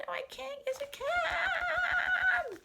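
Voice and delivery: very high squealed comeback, last word stretched; escalating argument shout. Acted. Friends bicker over pretending, escalating squealed shout. Story quarrel reaches its peak.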